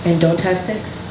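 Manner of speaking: put-on deliberate Haitian-accented mother, long pauses. Mother warning her daughter about boys, stern Haitian accent. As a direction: lay down rules in a Haitian accent, pausing between each. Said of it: "I'm gonna do the accent".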